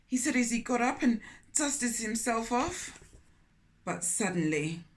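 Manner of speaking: put-on storytelling voice for children; gentle read-aloud storytelling; story narration. A narrator's reading. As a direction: read it like a picture book aloud. Narrating the fall.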